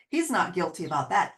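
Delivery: normal talking voice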